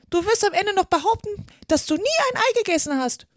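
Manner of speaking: high, loud bird tirade; scornful pigeon. A narrator's reading. Pigeon accusing Alice of eating eggs, scornful. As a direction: berate her as a shrieking pigeon.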